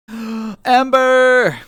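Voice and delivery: delighted gasping greeting; thrilled to see her; excited gasp. Real, not acted. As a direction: gasp then call the name out.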